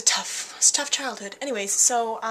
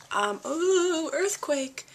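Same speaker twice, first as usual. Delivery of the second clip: ordinary talk, nothing marked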